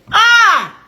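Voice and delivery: loud, high cry of disbelief; shocked "ah". Retold or imitated. Storyteller appalled by the lady's remark, shocked cry. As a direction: exclaim sharply, high and loud.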